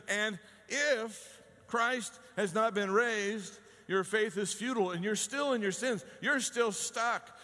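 Paul's words preached to congregation; preaching scripture. Acted. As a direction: proclaim it slowly. Preacher quoting Paul with force.

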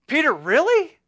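exasperated friend, Peter, really; incredulous. Retold or imitated. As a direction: roll your eyes at Peter. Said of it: "the response of the other disciples".

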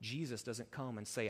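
ordinary talk, nothing marked

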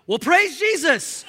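high, loud and drawn out; hammed-up hallelujah for a laugh; comic exuberant praise. Pastor answering a couple awaiting his response, hammed-up praise.

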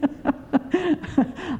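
a soft real chuckle; gentle real chuckle; soft laugh. Real, not acted. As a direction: let a quiet laugh out. Laughs after line 115.

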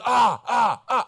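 a dad hamming up his son's aggressive grunting; imitated toddler grunts. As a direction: shout the grunts like a defiant two-year-old. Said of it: "He grunts at me".

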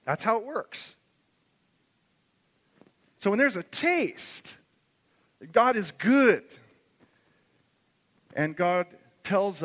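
rising pulpit fervor; fervent preaching. Acted. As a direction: preach it out with full conviction to the congregation. Preaching at full force.

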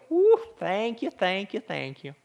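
lightly put-on Southern accent. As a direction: whoop it up like a grateful Southerner. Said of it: "She kinda had this Southern accent".

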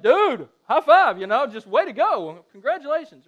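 loud, slowed, celebrating high five; a proud parent cheering her son in the car; excited praise. Retold or imitated. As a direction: congratulate him with big enthusiasm. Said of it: "I was like".

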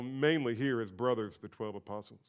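ordinary talk, nothing marked

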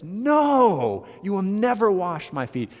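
high, loud, flat-out no; emphatic refusal. Retold or imitated. Peter refusing Jesus washing his feet, loud and high. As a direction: Peter slamming the door on the idea. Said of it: "Notice how emphatic he is there".

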